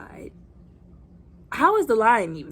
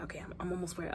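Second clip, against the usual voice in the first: whispered; 14 dB quieter, faster than usual. Retold or imitated. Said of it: "He's like".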